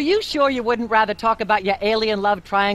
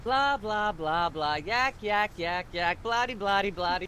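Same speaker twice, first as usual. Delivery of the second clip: soft background blather; 7 dB quieter than usual. Acted. Droning nonsense under other talk.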